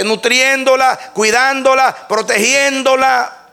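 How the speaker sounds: high, loud preaching proclamation; full-force preaching. Acted. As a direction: proclaim it to the congregation at the top of your voice. (predicación a todo pulmón, alta y fuerte)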